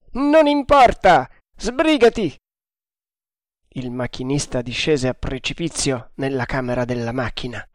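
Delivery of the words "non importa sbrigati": furious shout. A narrator's reading. Haider overriding the engineer's warning, loud shout. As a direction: cut him off and shout.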